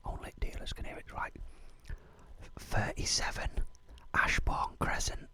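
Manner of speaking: breathy secret whisper of the address. Acted. MC whispering the address to his street crew. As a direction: hush it so only dealers hear. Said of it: "I'll just whisper the address".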